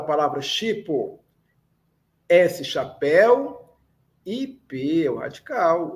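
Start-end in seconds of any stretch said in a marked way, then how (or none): none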